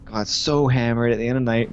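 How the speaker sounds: ordinary delivery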